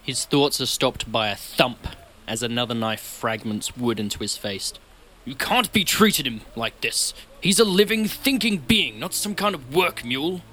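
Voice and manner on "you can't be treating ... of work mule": loud, high indignant protest; raised voice. A narrator's reading. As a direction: call out in outrage on someone's behalf. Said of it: "A voice breaks through the rain".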